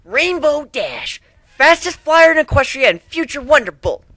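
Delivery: high, loud, boastful tomboy pony; Rainbow Dash voice. Acted. Rainbow Dash introducing herself to a new stallion, high and boastful. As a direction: brag your title like a champion flyer.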